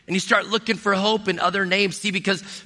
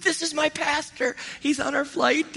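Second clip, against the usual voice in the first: higher, bright-eyed mock voice of a flight attendant; excited flight attendant; 10 semitones higher, 3 dB quieter than usual. Flight attendant tells a coworker her pastor is aboard, in a high mock voice. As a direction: play the thrilled attendant pointing out her pastor, pitched up. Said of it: "and she said".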